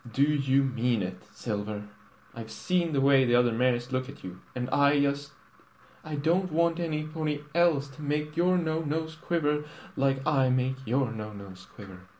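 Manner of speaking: a hint of a worried young mare begging; Twilight, pleading. Twilight voiced by narrator.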